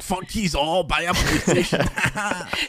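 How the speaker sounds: sing-song Geordie jab; mock Geordie. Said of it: "And then he was like".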